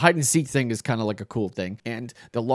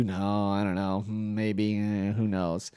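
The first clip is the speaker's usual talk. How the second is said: evasive deflecting; 2 semitones lower, 4 dB quieter, slower than usual. Retold or imitated. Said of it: "deflecting the issue".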